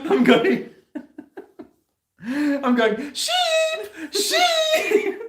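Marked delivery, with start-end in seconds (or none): 3.1-5.2 very high, sing-song call through laughter; Rachel impression. Owner mimicking Rachel calling the lamb, very high and laughing. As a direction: squeak it out like Rachel calling her lamb, giggling. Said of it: "doing my best Rachel impersonation".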